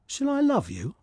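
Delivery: high, eager little swallow getting to the point. Acted. Swallow to the reed, high and eager. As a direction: chirp it up high like a small bird.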